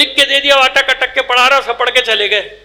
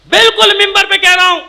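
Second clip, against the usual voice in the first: high, loud proclamation; emphatic pulpit declaration; 7 semitones higher, 5 dB louder than usual. Real, not acted. Preacher warns his congregation from the pulpit, high forceful declaration.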